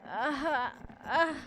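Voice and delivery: short high gasps of pain; pained cries. A narrator's reading. Narrator has fallen face first, crying out in short pained gasps. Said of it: "Tears streaming down my face".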